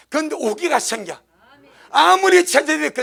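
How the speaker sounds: ordinary talk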